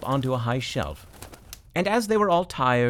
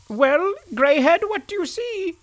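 rooster voice; 5 dB louder than usual. Acted. Rooster asking the donkey at the window, high and piping. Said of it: "asked the rooster".

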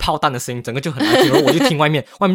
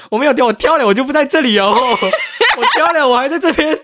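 laughing denial, higher and louder; 10 semitones higher, 4 dB louder than usual. Real, not acted. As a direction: laugh through the protest that you never jumped. (笑着否认自己跳楼)

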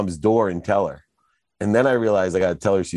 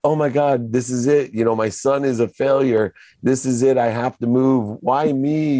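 higher, melodramatic mother's lament; mock woe-is-me outburst, pitched up; imagined lamenting mom; 6 semitones higher than usual. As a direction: wail mildly as a mom bemoaning her fate. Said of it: "that's what I envisioned in my mind".

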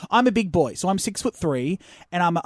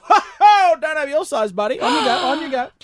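high, hollering vendor put-on; loud, high mock dismissal; mocking market vendor; 6 semitones higher, 4 dB louder than usual. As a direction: imitate a seller heckling a passer-by. Vendor loudly mocking a customer's size.

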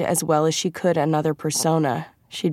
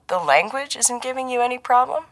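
high, rising mock-innocent question; too innocent; 8 semitones higher than usual. A narrator's reading. Handler teasing his agent about languages, a shade too innocent. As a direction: bait them with exaggerated innocence. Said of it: "voice was a shade too innocent".

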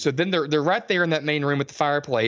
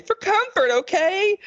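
mock Jessica; slower than usual. Mocking Jessica taking the knife, very high squeak. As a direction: squeak it like a prissy horror girl. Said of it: "one of them's like".

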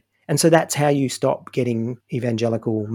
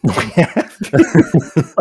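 laughing; 4 semitones higher, 5 dB louder, much slower than usual. Pretend. Mocking church phrase laughing.

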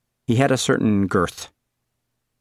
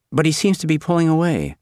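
put-on high girl's voice; 8 semitones higher than usual. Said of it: "she said".

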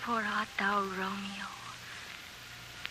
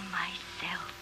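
whispering; 4 semitones lower, 12 dB quieter than usual. Acted. End of Juliet speech.

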